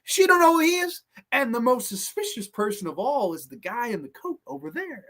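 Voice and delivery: high-pitched hammed-up old man voice; Jack Elam. Old man in the diner stirring up suspicion, high mocking impression. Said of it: "I think Jack Elam says it in the room. He goes".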